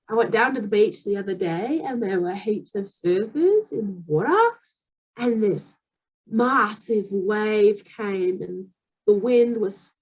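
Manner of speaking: Australian accent with questioning rises; chatty Aussie storyteller; put-on conversational Australian. Shown as a demonstration. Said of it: "Australian sounding words".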